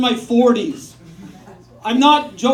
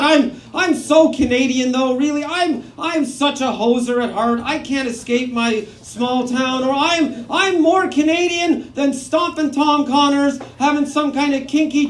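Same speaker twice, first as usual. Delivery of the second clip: ordinary talk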